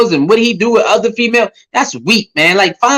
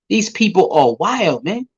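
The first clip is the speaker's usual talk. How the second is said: normal talking voice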